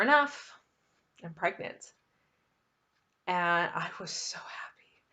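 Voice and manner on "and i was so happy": whispered happiness. Real, not acted. Telling viewers her joy, whispered. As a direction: hush it like a happy secret.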